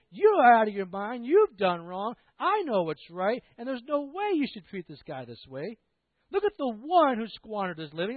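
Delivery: loud, high-pitched scolding; outraged older brother laying down the law; self-righteous anger. Acted. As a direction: lecture him indignantly from above. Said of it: "he positions himself above his father".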